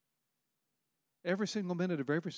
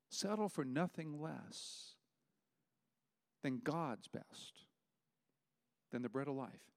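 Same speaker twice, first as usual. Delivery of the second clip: soft, slow urging; 9 dB quieter, slower than usual. Real, not acted. Preacher urging softly, slowly.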